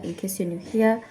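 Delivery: ordinary talk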